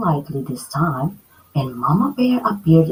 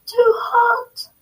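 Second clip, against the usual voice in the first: high, crying yelp; yelled. Acted. Girl burning her mouth on porridge, crying out high. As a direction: wail it as the spoon burns. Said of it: "she yelled".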